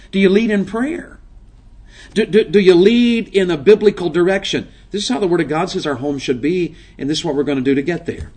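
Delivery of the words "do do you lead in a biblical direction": usual speaking voice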